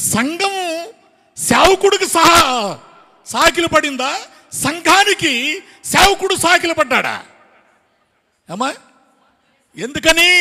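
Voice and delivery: high, loud questioning in short bursts; full-force preaching. Real, not acted. Preacher quizzes the congregation on who bowed down, full-force preaching.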